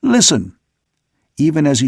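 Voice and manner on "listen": higher, more urgent "listen"; insistent order, pitched up. A narrator's reading. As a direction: command everyone to hush and hear. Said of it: "ordered the second mate".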